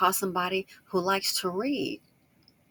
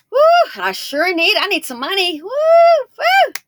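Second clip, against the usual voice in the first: high, loud whooping celebration; hammed-up elated cheering, shooting up in pitch; acted excitement; 12 semitones higher, 14 dB louder than usual. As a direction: whoop with joy at money coming in. Acts out joy at coming money.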